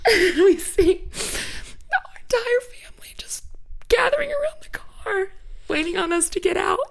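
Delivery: high, tearful, whispering through tears; real crying. Real, not acted. Telling the co-host about arriving home, crying in a high, breaking whisper. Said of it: "I'm sorry".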